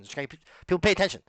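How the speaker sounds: usual speaking voice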